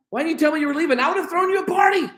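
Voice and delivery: high, fast hammed-up outburst, shouted at the end; mock indignant Laban. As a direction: play Laban as an offended host, overdone. Mock paraphrase of upset Laban.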